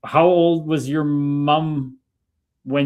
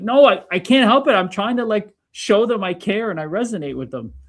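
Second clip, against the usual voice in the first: high-pitched defensive plea, retold; acted-out helpless self-justification; pleading protest; 8 semitones higher, 4 dB louder than usual. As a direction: protest to a scolding mom, voice shooting up. Acts out protest to mom's scolding.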